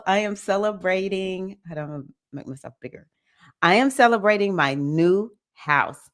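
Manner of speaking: usual speaking voice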